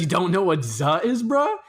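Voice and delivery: mock bro question, swooping way up on "bruh"; imitating bro friend. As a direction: imitate an incredulous bro, end rising sharply. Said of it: "He goes".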